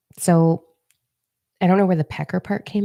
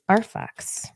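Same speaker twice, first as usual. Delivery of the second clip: playful whisper; 5 semitones higher, 4 dB quieter than usual. Acted. Vlogger leading a silly ritual for viewers, whispered. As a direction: whisper our fucks with a grin.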